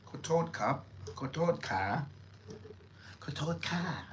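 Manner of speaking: Kotod ka with the soft female ka ending; Thai woman's polite phrase. Shown as a demonstration. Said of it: "That's what a woman would say".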